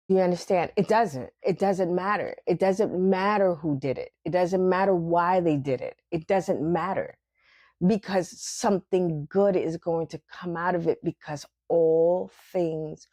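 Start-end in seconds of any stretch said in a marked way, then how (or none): none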